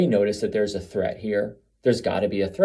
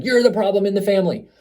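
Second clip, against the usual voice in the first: high, pointed accusation, a little louder; accusing; 9 semitones higher, 4 dB louder, faster than usual. Retold or imitated. Codependent parent blaming the narcissist, mock accusing. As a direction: point the finger and blame.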